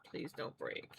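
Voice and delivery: soft unvoiced plea to the yarn; whispered plea. Real, not acted. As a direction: beg the thread in a whisper not to snap. Begging thin yarn not to snap.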